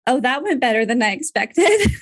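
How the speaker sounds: amused relief. Retold or imitated. Mother recalls daughter taking hard news well, laughing with relief. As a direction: laugh through the retold relief. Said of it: "it was kind of comical".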